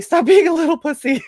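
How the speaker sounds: retold coach yell. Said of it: "He's like".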